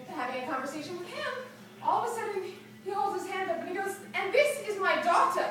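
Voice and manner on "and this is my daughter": louder, higher, roughly imitated Israeli accent; hint of an Israeli accent, pitched up; attempted Israeli accent. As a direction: introduce someone in a badly faked Israeli accent. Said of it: "obviously not an English accent".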